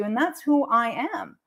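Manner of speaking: ordinary talk, nothing marked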